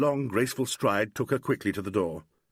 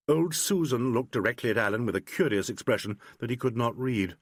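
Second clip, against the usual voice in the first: plain reading voice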